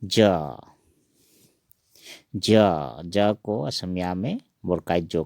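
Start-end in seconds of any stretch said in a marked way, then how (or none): none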